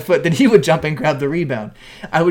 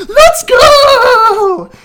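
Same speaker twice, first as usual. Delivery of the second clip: screamed sack celebration, very high and loud; hyped player yell; 12 dB louder than usual. Retold or imitated. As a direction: roar it out like a pumped-up linebacker. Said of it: "stands up and goes".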